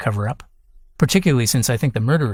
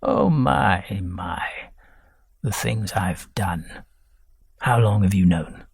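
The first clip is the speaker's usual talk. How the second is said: lightly voiced old man; 4 semitones lower than usual. Old husband ashamed of his past.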